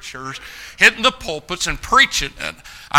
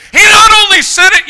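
much higher, louder shout; preaching shout; 13 dB louder than usual. Real, not acted. Preacher rousing the young men, shouting. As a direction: shout it out from the pulpit. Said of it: "preaching climax".

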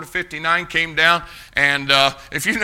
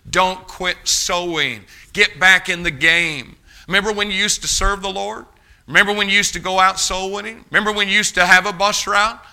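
rising, louder preaching push; preaching exhortation; 6 semitones higher, 5 dB louder than usual. Real, not acted. Pastor urging the church back to soul winning, building preacher's cry. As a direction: build the call to the pews, line on line.

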